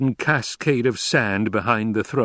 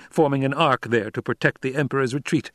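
ordinary delivery, nothing marked

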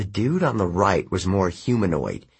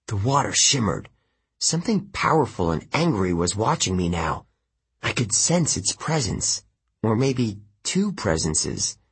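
usual speaking voice

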